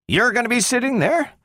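high, quick incredulous question; taken aback, rising to a high finish; startled exclamation. A narrator's reading. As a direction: exclaim it in disbelief, pitch jumping up. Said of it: "exclaimed Bogart".

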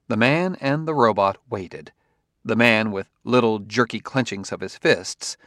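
usual speaking voice

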